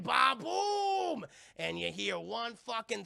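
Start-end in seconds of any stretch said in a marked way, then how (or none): 0.0-1.2 big slow shouted ba-boom; hyped explosion shout. Real, not acted. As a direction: blast the sound out. Imitating the explosion.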